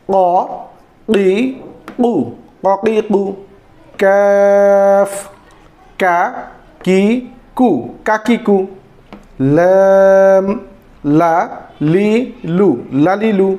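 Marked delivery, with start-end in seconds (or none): 4.0-5.1 very slow, stretched আইন; drawn-out letter name. Shown as a demonstration. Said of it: "teacher drawing out letter name". 9.4-10.4 very slow, stretched আইন; drawn-out letter name. Shown as a demonstration. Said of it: "teacher drawing out letter name".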